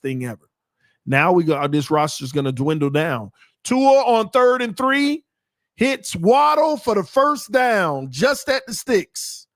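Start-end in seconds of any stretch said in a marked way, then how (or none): 3.6-9.5 slow, higher and louder football call; live play-by-play. Real, not acted. Commentator calls a third-and-three completion to viewers, play-by-play. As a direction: call the play, stretched and punched.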